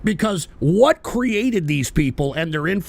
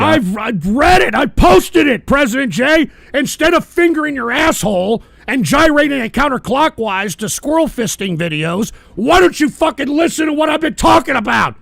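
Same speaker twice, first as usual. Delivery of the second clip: yelling higher and louder in real anger; furious shout; 6 semitones higher, 6 dB louder than usual. Real, not acted. Streamer yelling at a chat commenter, furious shout. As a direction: blow up at them, full volume.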